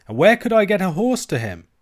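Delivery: high, loud exasperated retort; show of fury. A narrator's reading. Alan turns on the maid who suggests riding, furious. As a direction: round on her, loud and indignant. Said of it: "with the same appearance of fury".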